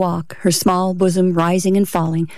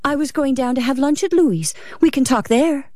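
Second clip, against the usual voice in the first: lightly voiced woman, pitched higher; higher voice for a hurrying wife, lightly done; breathless wife; 7 semitones higher than usual. A narrator's reading. Wife hurrying along with her husband, lightly breathless. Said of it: "Ruth hurried along breathlessly".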